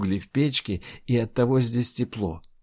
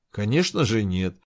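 usual speaking voice

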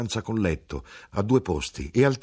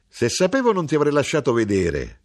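high, loud exclamation with hidden triumph; gongolante; 7 semitones higher, 6 dB louder than usual. A narrator's reading. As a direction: say it gloating, raised and forceful. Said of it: "era gongolante entro di sé". (tono acuto e forte, gongolante)

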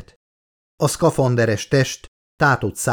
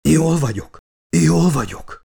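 higher, strained insistence; insisting; 6 semitones higher than usual. A narrator's reading. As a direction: insist he is fine through the strain. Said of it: "erősködött Charlie". (erősködő, feszült hang)

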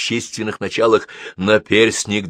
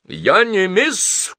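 grandma shouting; 9 semitones higher, much slower than usual. A narrator's reading. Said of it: "крикнула бабушка".

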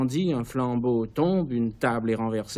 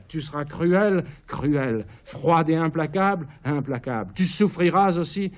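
higher, pressing insistence; feverish commands; 5 semitones higher, 3 dB louder than usual. Acted. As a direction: drive each word at her. Caligula dicte ses ordres à Késonia. (injonctions fiévreuses)

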